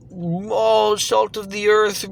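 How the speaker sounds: high, put-on sentimental voice; mock pitying. Mocks pitying view of criminals.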